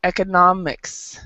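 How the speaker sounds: economics said eh-kuh-NOM-iks with the NOM stressed; stress in the middle. Shown as a demonstration. Teacher modelling economics for the class, stress on NOM. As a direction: put the weight on NOM in economics. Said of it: "the stress is actually in the middle".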